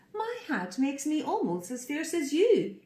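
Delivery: high, proud little pig voice; fierce boast. Acted. Pig boasting to the crocodile, high and proud. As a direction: puff up and brag.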